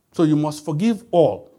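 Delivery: ordinary talk, nothing marked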